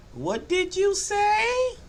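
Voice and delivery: put-on very high, slow child's question; granddaughter's voice. Grandfather imitating his granddaughter asking, very high and slow. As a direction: stretch it out like a little girl asking. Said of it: "what my granddaughter tells me".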